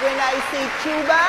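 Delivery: high put-on emcee voice; campaign emcee voice. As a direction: hype up the crowd like a host. Mock campaign host voice.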